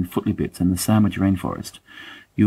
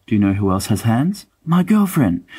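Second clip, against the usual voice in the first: lovestruck James; 3 semitones higher than usual. Retold or imitated. Mocking James as he brags to friends about his girlfriend, rising mock gush. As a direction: crow it out like a smitten schoolboy. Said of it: "the sentence".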